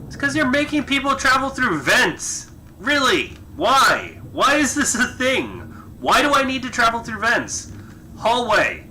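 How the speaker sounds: high-pitched disbelieving complaint; exasperated, pitch jumping up. Real, not acted. Exasperated rant at game design.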